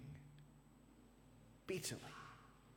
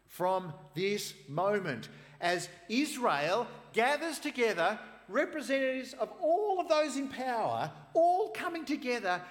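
much higher, loud, building passion; preaching at full force; 11 dB louder than usual. Acted. Preacher building the climax to his congregation, fervent and loud. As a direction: preach it to the rafters.

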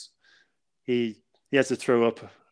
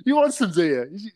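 higher-pitched retold challenge; mock confrontational; 8 semitones higher, 6 dB louder than usual. Brother confronting a drunk guy in the bathroom, mock confrontational. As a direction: square up to him, half in fun.

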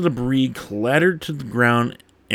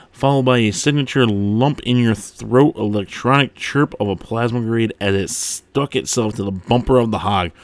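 normal talking voice

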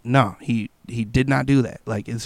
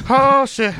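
high alarmed exclamation; high mock fright; 11 semitones higher, 3 dB louder than usual. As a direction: yelp it like bad news hit. Imagining his own reaction.

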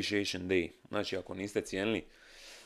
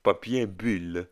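mock French accent; 3 semitones higher, 5 dB louder, much slower than usual. Shown as a demonstration. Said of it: "kako se kaže na francuskom".

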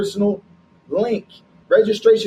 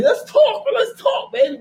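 much higher, eager burst; excited urging; 13 semitones higher than usual. Real, not acted. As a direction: pitch leaps up, egging a partner on. Eagerly cheers on co-host's answer.

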